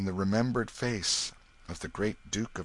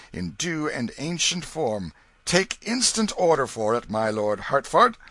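ailing king; 4 semitones higher, 7 dB louder than usual. A narrator's reading. King confirms the prince.

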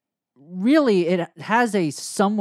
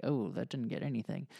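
quiet aside; 3 semitones lower, 10 dB quieter than usual. Retold or imitated. Reacting to a flat joke.

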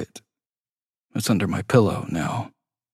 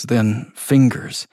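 ordinary delivery

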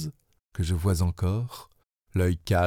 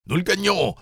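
excited cry; 10 semitones higher than usual. Acted. Harpooner cheering the crew as they close in, excited cry. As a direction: call it out, pitch leaping up in triumph. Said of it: "s'écria le Canadien".

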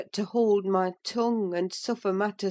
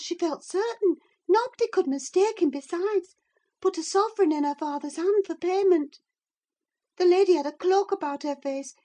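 put-on high-pitched, breathless-to-tell servant girl; agitated maid; 12 semitones higher, 4 dB louder than usual. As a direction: pour out the gossip in a high girlish voice. Maid recounts the gossip.

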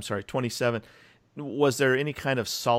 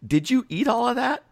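amazed disbelief; 12 semitones higher, 4 dB louder than usual. Real, not acted. Co-host amazed at food pile.

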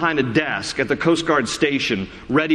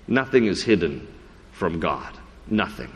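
hushed emphasis; 9 semitones lower, 5 dB quieter than usual. Acted. Preacher drops to hushed emphasis.

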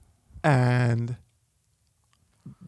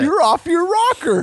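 loud high mock scolding; 9 dB louder than usual. Real, not acted. Laughing rant at grandpa.

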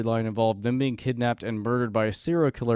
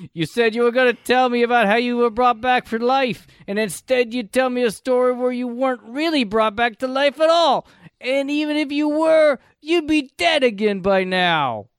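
much higher and louder, nasal-free mock whine in a Long Island accent; whiny Long Island kid; 11 semitones higher, 6 dB louder than usual. Host quoting Tommy's complaint to Prime, high mock Long Island whine. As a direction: squeal it like an indignant kid complaining. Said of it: "And that's how he delivered that".

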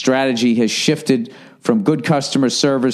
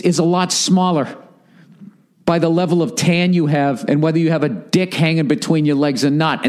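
usual speaking voice

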